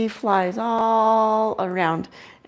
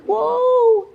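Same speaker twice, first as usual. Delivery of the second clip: surprised; 5 dB louder than usual. Real, not acted. Owl suddenly flies past.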